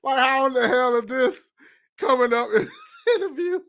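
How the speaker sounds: can't believe this came up; laughing high question; laughing disbelief. Real, not acted.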